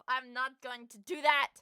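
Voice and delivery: normal talking voice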